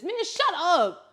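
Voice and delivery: high, drawn-out, loud dismissal; exasperated mock scolding. Fan fed up with singer's posts, playfully exasperated. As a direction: stretch it out in playful outrage.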